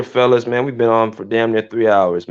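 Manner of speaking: ordinary talk, nothing marked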